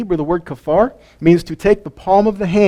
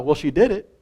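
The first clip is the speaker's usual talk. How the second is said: ordinary delivery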